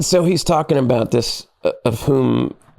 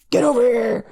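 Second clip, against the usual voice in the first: higher, barked mock command; mock yell; 12 semitones higher than usual. Captain yelling for the young lieutenant, mock bark. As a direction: play the captain calling him over. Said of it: "he yells at me".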